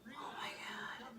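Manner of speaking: breathless whispered shock; horrified whisper. Acted. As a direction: whisper it in horror.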